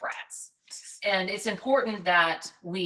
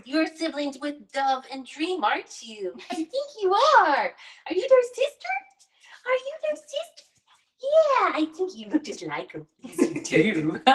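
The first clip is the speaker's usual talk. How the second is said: puppy talk; 10 semitones higher, 3 dB louder than usual. Real, not acted. Asking the puppy if she's Dove and Dream's sister, cooing. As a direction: coo at the pup, asking sweet questions.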